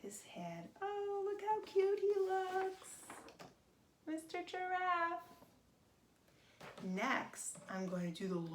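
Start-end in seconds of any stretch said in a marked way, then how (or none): 0.8-5.2 put-on much higher, sweet baby-talk coo; cute cooing. Showing kids the finished giraffe, high cooing. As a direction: coo over it like a cuddly pet.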